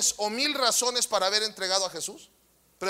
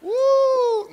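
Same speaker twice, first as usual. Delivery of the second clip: high playful whoop; 13 semitones higher, 6 dB louder than usual. Real, not acted. Playful exclamation.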